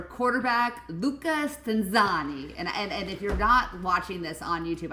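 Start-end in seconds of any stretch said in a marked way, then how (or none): 0.0-2.5 slow, hammed-up Italian accent, higher; over-the-top fake Italian accent; bad Italian accent. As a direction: savour Luca Stanzani in a comic Italian accent. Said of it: "Don't clip the bad Italian accent".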